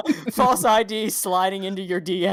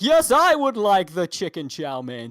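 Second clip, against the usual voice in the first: slow, loud TV anchor voice; newscaster voice; 7 dB louder, slower than usual. Retold or imitated. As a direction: order dinner like a news host. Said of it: "is that just how he talks".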